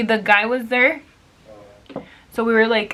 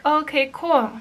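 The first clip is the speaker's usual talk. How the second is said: higher-pitched fake-friendly acceptance; mock agreeableness; 6 semitones higher than usual. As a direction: pretend to go along, sarcastically. Imagines absurdly agreeing with the harasser.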